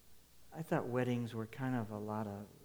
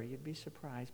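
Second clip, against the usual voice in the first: ordinary talk, nothing marked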